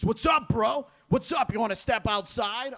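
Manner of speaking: confrontational tough guy. Acted. Tough guy getting in comic's face, loudly confrontational. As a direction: square up like a bro picking a fight. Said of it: "gets in your face".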